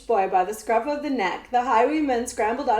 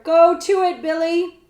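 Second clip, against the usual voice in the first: loud, high egging on; eager urging; 7 semitones higher, 8 dB louder than usual. A narrator's reading. Cutwater eggs Billy on to whip the prince, loudly. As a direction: cheer him on to whip.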